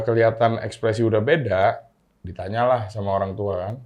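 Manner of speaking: normal talking voice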